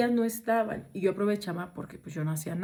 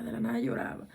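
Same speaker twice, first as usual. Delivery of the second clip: whispered echo; 8 semitones lower, 6 dB quieter, faster than usual. Shown as a demonstration. Narrator whispers the crying girl's habit to listeners, hushed. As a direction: drop to a low, hushed whisper on "de la nada lloraba". Repeats the line, then whispers it.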